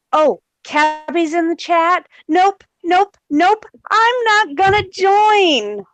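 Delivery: high, mock-panicked nope nope nope; lightly voiced scared coward backing out; slow I'm not gonna join, said in mock fright. Mocks the coward fleeing chat.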